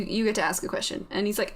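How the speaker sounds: ordinary delivery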